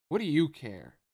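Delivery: loud, irritated snap; annoyed retort. A narrator's reading. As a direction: bite back at him. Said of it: "retorted, sounding enormously annoyed".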